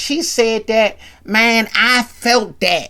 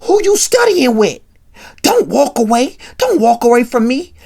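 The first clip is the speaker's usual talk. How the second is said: loud, raised angry demands; yelling; 6 semitones higher, 6 dB louder than usual. Retold or imitated. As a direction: act out a boyfriend yelling at his girlfriend. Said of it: "he yelling at her".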